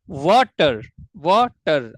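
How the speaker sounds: Water split as वा, टर with a clear break; broken into syllables. Shown as a demonstration. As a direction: sound out water in two separate beats. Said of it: "अब इसमें दो break आ गई". (Water को दो हिस्सों में तोड़कर बोलें)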